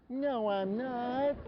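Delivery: high, very slow whiny denial; mock kid whine, long and high; whining child. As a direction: whine back, dragging out every word. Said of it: "kids who whine".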